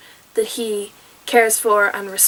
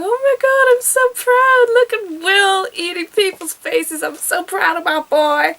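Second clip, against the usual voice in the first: proud Hannibal; 7 semitones higher than usual. Retold or imitated. Hannibal watching Will bite a face, gushing with pride. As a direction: gush like a doting parent. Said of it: "Hannibal looking at him going".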